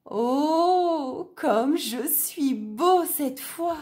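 high, slow, admiring gasp; proud wolf, delighted and high. A narrator's reading. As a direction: marvel at himself in the mirror.

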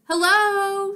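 high, loud drawn-out call; yell. A narrator's reading. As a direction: call out to someone indoors. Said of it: "she yelled".

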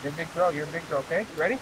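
soft coaxing encouragement. Real, not acted. Comforting daughter after hook stab.

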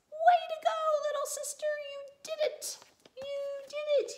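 high, loud cheer of praise. Acted. Brother praising sister for using the potty, cheering. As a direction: cheer her on like a proud fan.

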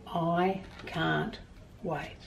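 ordinary delivery

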